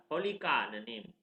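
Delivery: ordinary delivery, nothing marked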